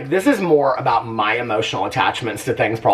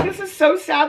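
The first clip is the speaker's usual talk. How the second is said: mock sad whine. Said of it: "so sad for him".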